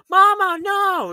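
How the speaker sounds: put-on high, drawn-out child's cry; imitated freaking out. Imitating little girl seeing her mother, mock panicked cry. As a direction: wail it slowly like a panicked little kid. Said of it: "you know, freaking out".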